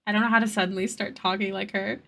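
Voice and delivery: usual speaking voice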